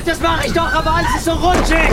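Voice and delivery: high, loud straining cry; desperate shout. Acted. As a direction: yell in panic while holding. Friend slipping from grip.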